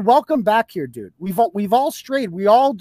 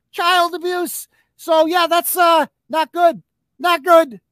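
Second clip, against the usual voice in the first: raised, higher "not good" repeated; 6 semitones higher than usual. Real, not acted. Exasperated ranting about critics.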